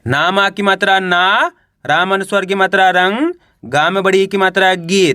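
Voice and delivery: teacher sounding out ना, रंग, गी one by one; syllable-by-syllable spelling. Shown as a demonstration. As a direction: stretch ना, रंग, गी with rising ends. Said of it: "अब उच्चारण कैसे करेंगे चलिए देखिए".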